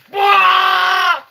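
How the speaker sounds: loud, high drawn-out mock-fright shout; alarmed yell. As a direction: yell it as if jumped at. Imagining fright in the tunnel.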